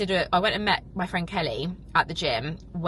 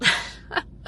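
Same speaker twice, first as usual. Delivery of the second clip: teary breathy laugh. Real, not acted. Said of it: "before I start literally crying".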